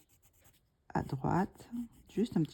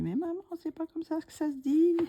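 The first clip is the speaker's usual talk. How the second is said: much higher mock child's voice; imitating her son; 11 semitones higher, 3 dB louder than usual. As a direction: pipe up high as a son correcting mum. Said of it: "mon fils me, m'écouterait, il me dit".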